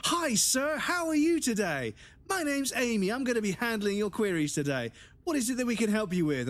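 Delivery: high, sing-song customer service voice; over-the-top American server. Retold or imitated. Mocking chirpy American server to a customer. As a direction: gush like an overeager waitress. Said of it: "they're so over the top with it".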